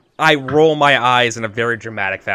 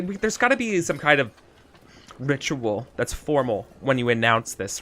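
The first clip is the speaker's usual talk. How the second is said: ordinary talk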